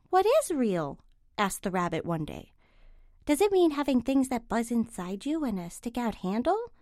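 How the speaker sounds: gentle, slow bedtime read; storybook reading. Acted. Reading The Velveteen Rabbit to children, gentle.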